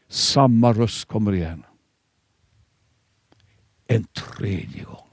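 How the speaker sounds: ordinary talk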